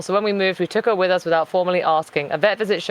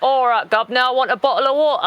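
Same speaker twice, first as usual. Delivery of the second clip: higher, caricatured Cockney British; mock Cockney accent; 5 semitones higher than usual. People asked for their best British accent, mock Cockney. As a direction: put on a joke Cockney accent. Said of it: "It wasn't Cockney".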